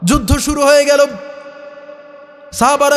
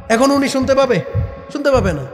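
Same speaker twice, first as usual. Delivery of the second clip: normal talking voice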